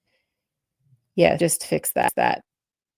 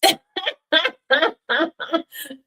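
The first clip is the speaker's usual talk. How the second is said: high cackling laugh; long laughing fit; 10 semitones higher, 5 dB louder than usual. Real, not acted. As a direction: crack up for a while. Laughs after teasing.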